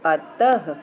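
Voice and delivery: अतः low and firm, ending on an audible h; visarg as h. Shown as a demonstration. Teacher gives another visarg example, final h sounded out. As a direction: punch "tah" in अतः with a closing breath. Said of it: "इसका उच्चारण ह की तरह होता है".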